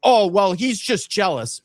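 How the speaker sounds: mock hater voice, high and slow; mocking a whiny viewer. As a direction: sneer it like the haters do. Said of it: "they sit here and go".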